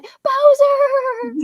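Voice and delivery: high, loud call of a name. Retold or imitated. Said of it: "He'd be like".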